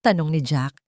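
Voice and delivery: usual speaking voice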